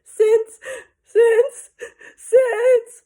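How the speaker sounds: high, loud sobbing stammer; a child crying too hard to finish. Acted. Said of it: "Fudge sobbed".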